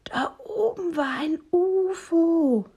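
put-on high, loud, drawn-out child voice; high-pitched, slow kiddie exclamation; little raccoon son. A narrator's reading. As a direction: announce it like an amazed little kid. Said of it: "als ihr Sohn meint".

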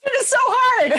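high, loud laughing wail; excited exclaim. Real, not acted. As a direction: cry out in playful agony. Agonizing over quiz choice.

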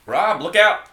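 high, loud alarm call; warning shout. Acted. Warning shout in fight scene.

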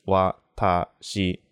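"watashi" slowly, as three separate equal beats wa, ta, shi; equal syllable lengths. Shown as a demonstration. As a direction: spell out "watashi" beat by beat, each syllable equally long. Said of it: "is, like, the same length".

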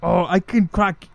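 loud action-star impersonation; high, shouted mock Austrian accent; Arnold Schwarzenegger. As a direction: bellow it like the famous actor. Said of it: "I'm Arnold".

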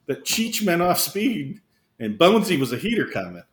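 ordinary delivery

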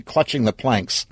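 ordinary delivery, nothing marked

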